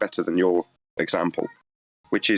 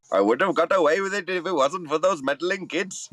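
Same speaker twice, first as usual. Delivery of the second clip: put-on Indian accent, pitched up; a hammy Indian accent on a cartoon villain line; 9 semitones higher than usual. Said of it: "I just wanna do my Indian accent".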